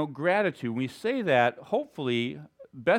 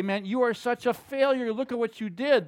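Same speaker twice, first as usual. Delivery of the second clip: mocking Satan; 7 semitones higher than usual. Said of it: "Satan will come and look at it and twist and say".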